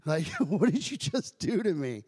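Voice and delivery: slow, laughing disbelief; retold bewilderment. Man retells asking chaplain what just happened, bewildered laugh. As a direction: laugh through the baffled question.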